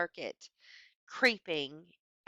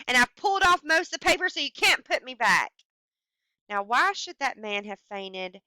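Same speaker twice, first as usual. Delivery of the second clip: dramatic story reading; 7 dB louder than usual. Acted. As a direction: read it like the story's creeping climax. Reads the story's ending aloud.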